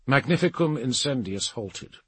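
usual speaking voice

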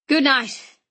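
hearty, emphatic GOOD NIGHT from an uncle. Acted. Uncle to departing nephew, hearty good night. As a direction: call out "Good night" heartily, falling at the end.

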